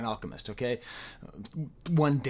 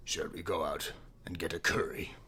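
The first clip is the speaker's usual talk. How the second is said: a lightly put-on British accent, low and soft; a hint of a British accent; 7 semitones lower, 6 dB quieter than usual. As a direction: say it like a casual Londoner, lightly. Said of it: "British accent".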